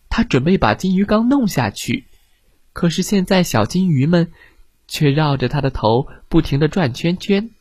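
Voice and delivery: ordinary delivery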